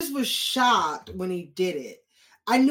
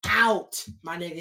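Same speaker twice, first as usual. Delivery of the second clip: normal talking voice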